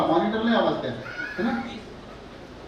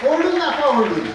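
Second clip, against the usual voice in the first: high, raised-voice prompt; urging the crowd; 5 semitones higher, 4 dB louder than usual. Real, not acted. Host tells the audience to shout it out, urgent rallying call. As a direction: spur the audience to shout back.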